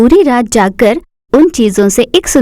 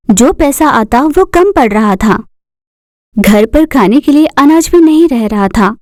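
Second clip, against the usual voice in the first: plain reading voice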